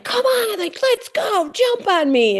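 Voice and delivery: put-on very high, excited kid's plea; imitating eager child. Mother imitating her son urging his brother to wrestle, excited. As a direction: egg a brother on in a squealed child voice.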